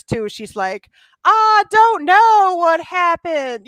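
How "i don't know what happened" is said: slow, high, hammed-up Southern drawl mocking a bad actress. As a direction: overact it like a terrible Southern belle, stretched and loud. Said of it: "her terrible Southern accent".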